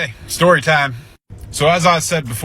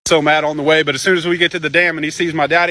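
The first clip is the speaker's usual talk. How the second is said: usual speaking voice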